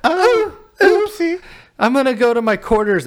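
very high, breezy little oopsie; mock oopsie. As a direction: play it innocent and ditzy. Mimicking Rose dropping diamond.

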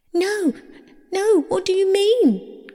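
very high, dismayed outcry; alarmed cry. A narrator's reading. Persephone realising she may be trapped, crying out. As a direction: pitch leaps up in protest. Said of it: "cried Persephone".

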